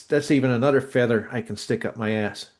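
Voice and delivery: usual speaking voice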